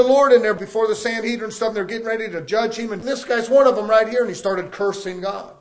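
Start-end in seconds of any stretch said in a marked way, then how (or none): none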